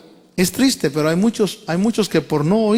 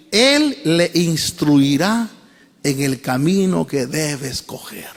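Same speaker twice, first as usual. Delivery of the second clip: very slow, measured reading; lectura despacio; 3 semitones lower, slower than usual. Shown as a demonstration. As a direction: read it slowly, word by word, easing down at the end. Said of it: "lo voy a leer despacio". (lectura muy pausada, palabra por palabra)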